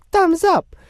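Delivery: very high, cartoonish squeak; squeaky playful echo. Host echoes a listener's cheer, playful high squeak. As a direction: chirp it in a silly falsetto.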